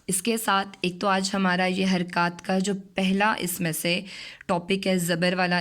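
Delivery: ordinary delivery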